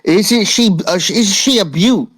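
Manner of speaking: put-on a biker in a cheery British accent admiring a fancy Pokémon. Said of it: "He reacts to your accent".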